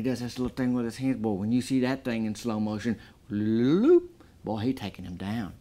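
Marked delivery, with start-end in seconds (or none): 3.3-4.1 sound effect. Retold or imitated. Miming the slow-motion arm move.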